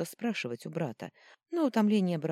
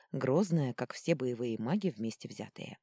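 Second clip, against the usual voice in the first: ordinary delivery, nothing marked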